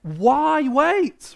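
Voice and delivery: loud, high protest, voicing the sisters; acted exasperated plea; a bit angry. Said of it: "maybe a bit angry with Jesus".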